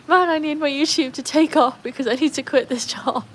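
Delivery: hammed-up wail about work; mock desperation. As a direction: plead in playful exasperation. Acting out own job frustration.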